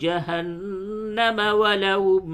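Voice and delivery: slow, high, drawn-out melodic chant; Quran recitation. Shown as a demonstration. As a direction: chant the full verse slowly with heavy lam in Allah.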